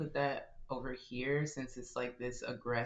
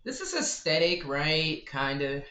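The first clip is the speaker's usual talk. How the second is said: louder, higher playful question; excited; 4 semitones higher, 9 dB louder than usual. Real, not acted. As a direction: show off the page with glee. Excited about scrapbook page.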